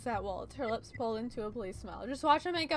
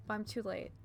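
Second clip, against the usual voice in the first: ordinary delivery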